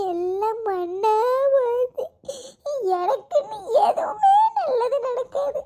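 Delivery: slow, broken lament with sobs and half laughs; tearful wail. Acted. Woman mourning the lost treasure in a slow, sobbing lament. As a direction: drag out the self-pity, crying.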